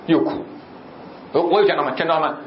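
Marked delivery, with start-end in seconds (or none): none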